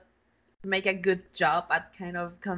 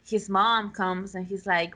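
normal talking voice

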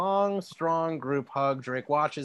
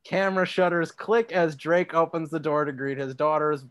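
ordinary talk

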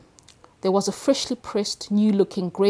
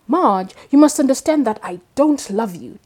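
a lightly voiced Dickie, higher on the stressed words; Dickie's voice; 5 semitones higher, 10 dB louder than usual. Tom rehearsing to the mirror, a hint of Dickie's voice. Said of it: "Tom said into the mirror in Dickie's voice".

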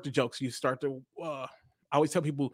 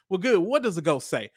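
ordinary talk

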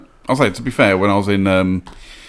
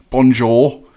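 stilted, louder schoolboy French accent; 4 semitones higher, 6 dB louder, slower than usual. Shown as a demonstration. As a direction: recite it like a nervous pupil. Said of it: "Schoolboy French".

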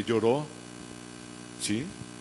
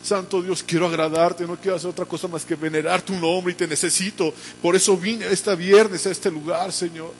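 fervent prayer; 3 semitones higher than usual. Acted. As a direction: pile up the pleas to God with growing urgency. (oración ferviente que va creciendo)

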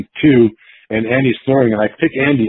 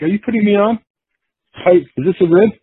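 higher, louder disbelieving question; incredulous; 11 semitones higher, 4 dB louder than usual. Retold or imitated. Kurt asking a dazed Andy if this is a rib, incredulous. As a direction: ask if it's a joke, stunned.

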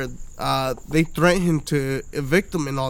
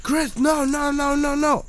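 mock panicked pleading, pitched way up; alarmed friends; 8 semitones higher, slower than usual. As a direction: mimic friends holding someone back. Friends holding him back, retold.

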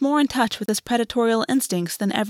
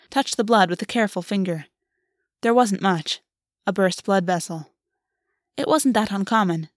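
ordinary delivery, nothing marked

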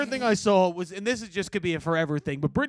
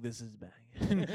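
low, slow, lazy half-laugh; stoned and sleepy; 8 semitones lower, 7 dB quieter, slower than usual. Retold or imitated. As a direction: mumble it half asleep on the couch. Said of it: "my eyes half open like".

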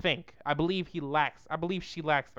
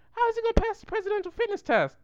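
much higher, hammed-up disbelief; incredulous high voice; faster than usual. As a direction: replay your disbelief in a very high voice. Repeats his own incredulous reaction.

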